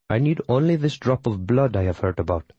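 put-on light Tamil-accented witch voice; calm, measured, mild Tamil accent. A narrator's reading.

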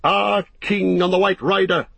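high, ringing cry of a host; shouted acclaim. A narrator's reading. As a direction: hail the leader as one of a cheering army. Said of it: "they shouted".

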